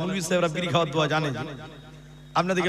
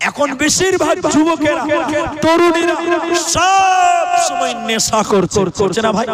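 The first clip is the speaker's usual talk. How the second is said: high, loud, drawn-out cry; full-force preaching; 8 semitones higher, 8 dB louder, much slower than usual. Real, not acted. Preacher to the crowd about addicted youth, full-force preaching. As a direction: hurl it at the crowd, stretching the words.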